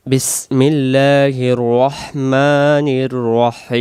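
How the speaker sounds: drawn-out melodic recitation; chanted Quranic recitation; slow Quran recitation. Shown as a demonstration. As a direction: recite it slowly and devoutly. Recites in tajwid style.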